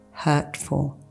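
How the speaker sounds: usual speaking voice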